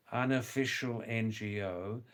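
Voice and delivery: unofficial leaned on hard with a rise; stress on unofficial. Shown as a demonstration. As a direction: hit the word unofficial. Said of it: "I stress the word very strongly".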